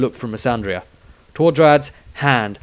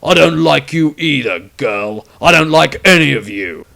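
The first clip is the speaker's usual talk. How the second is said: loud, flat menace; cold hostility; 4 semitones higher, 8 dB louder than usual. A narrator's reading. Witch hunter with sword at her throat, loud cold dislike. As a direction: threaten each word. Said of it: "sword at her throat".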